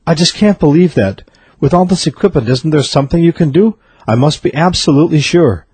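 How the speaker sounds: desperate disbelief. A narrator's reading. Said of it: "the worried man".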